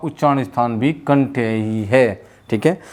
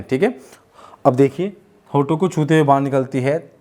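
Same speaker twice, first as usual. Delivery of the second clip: normal talking voice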